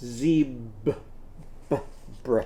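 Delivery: zebra broken into its sounds; a teacher sounding out the blend; segmenting sounds. Shown as a demonstration. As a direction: clip each sound apart. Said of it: "And what comes next?".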